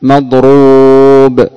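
مضروب stretched long and slow; drawn-out example word. Shown as a demonstration. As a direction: hold مضروب long so students hear the pattern. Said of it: "إذا قلنا". (مضروب ممدودة ببطء)

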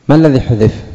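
ordinary delivery

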